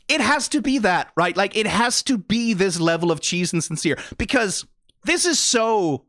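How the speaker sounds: ordinary delivery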